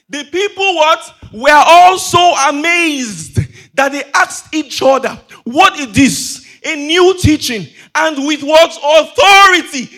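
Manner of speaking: loud, high, drawn-out proclamation; full-force preaching. Real, not acted. Preacher reads how the people were amazed, booming proclamation. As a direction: proclaim the crowd's amazement with stretched key words.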